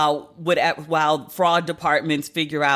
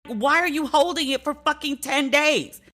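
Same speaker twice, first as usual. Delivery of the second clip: high-pitched, outraged disbelief; livid rant; 9 semitones higher than usual. Real, not acted. Ranting to listeners about the bank holding her check, high and outraged. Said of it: "Can you hear that I'm livid?".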